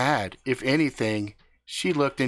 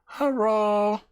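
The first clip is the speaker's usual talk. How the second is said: high hello with r for l, herolo; Hello bent to herolo, higher; accented hello, herolo; 9 semitones higher than usual. Retold or imitated. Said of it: "came out sounding like".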